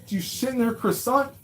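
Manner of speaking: high-pitched disbelief; incredulous. Real, not acted. Streamer to viewer about grandmother, high incredulous. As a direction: pile on the questions, half laughing.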